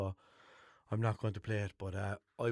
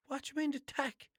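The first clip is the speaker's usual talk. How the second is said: higher, baffled mock question; imitating his own confusion. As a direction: play the puzzled brother, pitched up. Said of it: "I goes".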